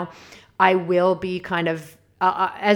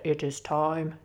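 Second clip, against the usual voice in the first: solemn spirit voice; 3 semitones lower, 7 dB quieter than usual. Retold or imitated. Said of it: "They don't speak like that".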